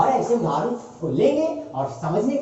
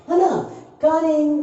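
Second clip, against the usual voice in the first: usual speaking voice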